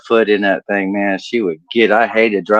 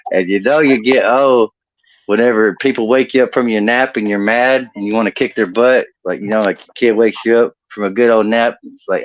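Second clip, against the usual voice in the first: normal talking voice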